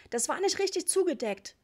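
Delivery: put-on high, loud child's complaint; discontented shout. A narrator's reading. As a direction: yell it as a dissatisfied little boy. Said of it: "schrie er unzufrieden". (unzufriedenes, hohes Kindergeschrei)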